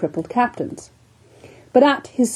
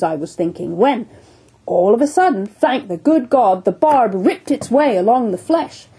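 put-on raised, booming old captain's voice; hearty sea captain; 3 semitones higher than usual. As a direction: tell it like a bluff one-armed whaler. Captain Boomer telling his tale.

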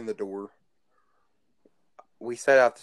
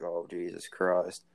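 slow, drawn-out "Oh, Jesus Christ" with a lower pitch; mock-horrified sigh of disbelief; exasperated disbelief; 4 semitones lower, 5 dB quieter, slower than usual. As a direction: drag "Christ" out like you can't believe it. Reacting to vagina piercing.

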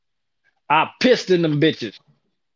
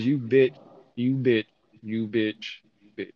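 repeats it softer and softer until barely voiced; fading mouthed insult; 6 semitones lower, 6 dB quieter than usual. Mouthing bitch at the manager, fading to near silence. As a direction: swallow the insult, trailing to nothing.

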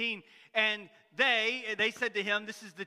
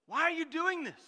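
indignant; 7 semitones higher than usual. Retold or imitated. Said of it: "They were indignant".

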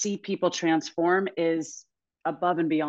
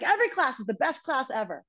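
gushing client; 7 semitones higher than usual. Retold or imitated. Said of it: "She's like".

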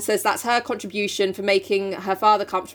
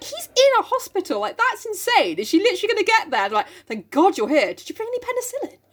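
mock incredulous; 11 semitones higher than usual. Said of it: "I'm like".

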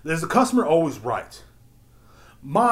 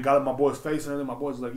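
ordinary delivery

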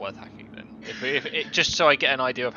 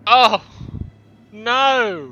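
loud, high cry of dismay; dismayed shout; 9 semitones higher, 13 dB louder than usual. Real, not acted. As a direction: shout it at the bad roll. Failed dice roll.

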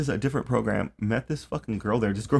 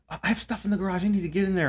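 higher insistent voice; whiny client; 9 semitones higher, faster than usual. Retold or imitated. Caseworker mimicking a hoarder client's phone demands, higher and whiny. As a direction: plead a bit higher. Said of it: "she would call".

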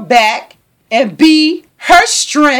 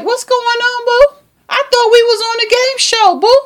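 high, bewildered whiny voice, pitched way up; confused boyfriend; 9 semitones higher than usual. Retold or imitated. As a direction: play him baffled and pleading, high and thin. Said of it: "And he like".

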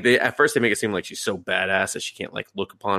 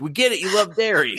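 exasperated shout; 11 semitones higher than usual. Retold or imitated. Teasing the lizard guy about his dairy obsession, mock shout. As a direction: yell it like you've had enough. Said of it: "And I'm like".

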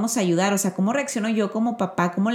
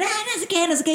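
put-on whiny child; 10 semitones higher, faster than usual. Mother voices her son's tantrum.

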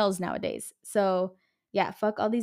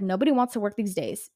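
ordinary delivery

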